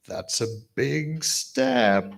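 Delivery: slow, lightly Scottish understatement; Gordy speak. Retold or imitated. Best man quoting the groom's dry understatement, slow with a light Scottish accent. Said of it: "In Gordy speak".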